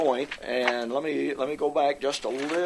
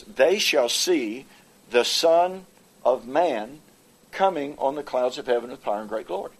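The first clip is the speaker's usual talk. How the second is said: solemn scripture reading. Acted. Reading scripture quote slowly.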